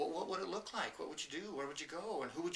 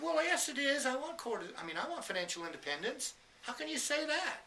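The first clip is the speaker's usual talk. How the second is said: indignant protest; 10 semitones higher, 4 dB louder than usual. Retold or imitated. Woman protesting on stage.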